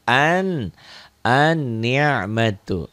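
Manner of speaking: very slow An, an-ni'matu, doubled n held long; slow model reading. Shown as a demonstration. Teacher repeats the word for pupils, very slowly. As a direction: stretch an-ni'matu out for learners. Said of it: "jangan al-ni'matu".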